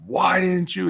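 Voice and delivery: high-pitched mock chewing-out; cussing out. Coach cussing out a player mid-game, mock angry outburst. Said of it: "straight cuss you out".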